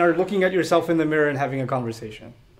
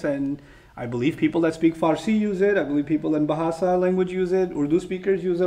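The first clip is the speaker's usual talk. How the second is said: ordinary talk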